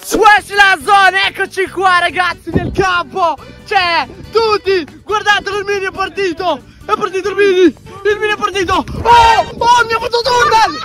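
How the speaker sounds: high, shouted play-by-play; hyped sports commentary. Acted. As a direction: call the match like a frantic commentator. Frantic mock match commentary.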